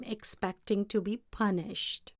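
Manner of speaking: ordinary delivery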